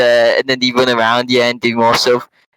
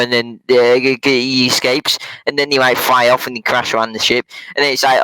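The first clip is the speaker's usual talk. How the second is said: ordinary delivery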